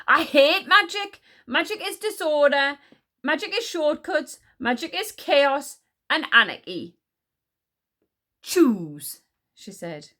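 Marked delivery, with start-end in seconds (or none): 0.1-7.0 high, loud hatred; angry rant. A narrator's reading. Queen declaring her hatred of magic, ranting angrily. 8.4-9.2 loud, falling one-word order; commanding. A narrator's reading. Queen giving the enchanter an ultimatum, commanding.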